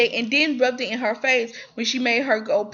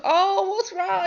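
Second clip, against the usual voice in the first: much higher, slow, put-on sweet concern; mock concerned woman; 9 semitones higher, slower than usual. Attorney calling the tired lead, mock concern. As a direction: play the worried caller in a sugary voice.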